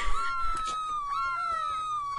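a burst of high, shrieking laughter at the kill; excited high laugh. Real, not acted. Gamer gets a kill and shrieks with laughter. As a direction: laugh out in sudden glee.

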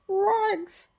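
high, loud dog yelp; talking dog's alarmed cry; Scooby crying out. Acted. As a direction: yelp it like a scared cartoon dog. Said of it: "cried Scooby".

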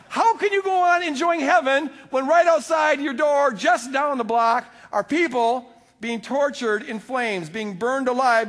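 preaching shout. Real, not acted. Preacher protesting to the congregation, shouting. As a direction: cry out against the idea.

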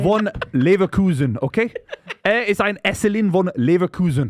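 emphatic, deliberate German accent. Shown as a demonstration. Joking about a player from Leverkusen, German accent. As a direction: declaim it in a put-on German accent. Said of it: "that's my German accent".

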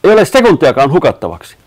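high, loud rallying cry; urgent shout. A narrator's reading. Matti urges the group not to waste time, urgent shout. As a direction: yell to spur them on. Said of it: "Matti huusi".

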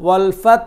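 ওয়াল ফাত said with the lips rounded on ফাত. Shown as a demonstration. Teacher showing how to round the lips on ওয়াল ফাত. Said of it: "মুখটাকে গোল করে দিলেই হবে".

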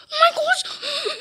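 high, drawn-out alarm; play-acted fright. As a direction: stretch it in squealed mock horror. Alarmed at approaching zombies.

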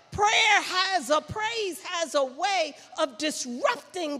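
loud, driving preacher's voice; full-force preaching. Acted.

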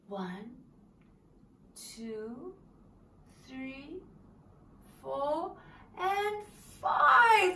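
slow hushed count bursting on the last number; slow count to five. Shown as a demonstration. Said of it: "slowly open my eyes like this".

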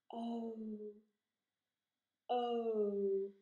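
O drawn out, rounding smaller as it glides; long vowel O. Shown as a demonstration. Teacher shows how the mouth moves, O stretched long. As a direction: stretch O firmly from open to tight lips. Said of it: "what sound this long sound this makes".

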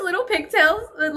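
normal talking voice

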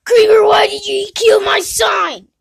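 high, loud crying shout; shrill furious complaint; outraged yell. Acted. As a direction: wail at Creeper over the sign. Creeper breaks his sign.

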